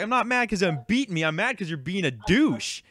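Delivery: high, emphatic retold protest; mock-exasperated, swooping pitch; exasperated retort. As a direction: hammer the point home to an annoying kid. Retold quarrel with the kid.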